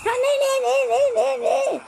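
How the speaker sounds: high, loud exasperated yelling; furious high-pitched rant; exasperated shouting. Real, not acted. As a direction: yell in fury at the game. Raging at game.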